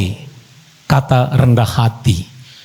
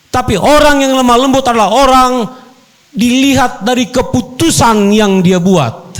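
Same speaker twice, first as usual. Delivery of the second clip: preaching at full force; 9 semitones higher than usual. Acted. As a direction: preach it out to the couple with fire. Preacher raises his voice.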